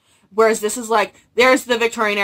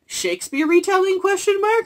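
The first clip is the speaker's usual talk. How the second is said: much higher, rising quizzical read; mock questioning listing; 11 semitones higher, 3 dB louder than usual. Quoting the vague Goodreads listing, mock puzzled. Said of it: "it was literally, like".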